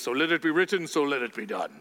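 a hint of an old film decree; epic movie pharaoh. Pastor imitating a lightly voiced Yul Brynner decree. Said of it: "a version of Charlton Heston and Yul Brynner".

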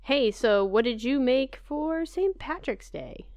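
ordinary talk, nothing marked

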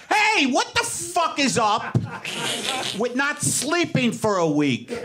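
high, slow, overblown comic delivery; hammed-up stand-up bit. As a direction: belt it out like a cheesy stand-up opener. Mock stand-up bit for Uber driver.

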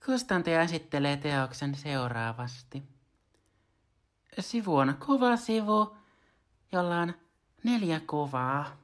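high, slow put-on reader voice; imitating a high-voiced audiobook narrator; Soila Vartija. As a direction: pitch it up and stretch the phrases. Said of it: "yritänpäs imitoida Soila Vartija".

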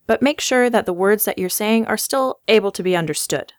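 usual speaking voice

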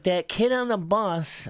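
high, slow imitation of an upset little boy; mock-tearful kid starting a complaint; teary child. Seven-year-old telling his mom about a bully, teary and high. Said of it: "he got a little bit teary-eyed".

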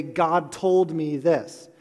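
slow, weighty "God told me this" with "told" hit hard; mock overconfident. Preacher mocking overconfident believers, slow and heavy on "told". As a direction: drag out "God told me this" like a know-it-all. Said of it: "people who a little too confidently say".

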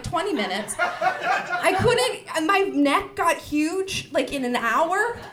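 ordinary delivery

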